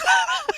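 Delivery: sudden high-pitched laugh; high burst of laughter. Real, not acted. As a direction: break into a squeal of laughter. Laughing at own story.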